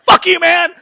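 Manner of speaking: yelled high and loud at the phone; mock furious yell. Angry customer yelling at support rep, mock furious shout. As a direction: blow up at the rep in mock rage. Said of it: "And I'm just like".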